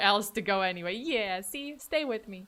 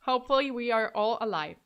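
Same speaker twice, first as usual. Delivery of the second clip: ordinary delivery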